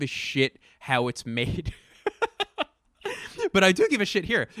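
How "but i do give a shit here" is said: booming, higher bear voice with a laugh in it; bear in a big blue house voice. Shown as a demonstration. Comedian making his point as a cartoon bear, loud and laughing. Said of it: "That's how I speak when I'm making a point".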